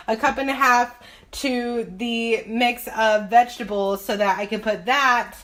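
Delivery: usual speaking voice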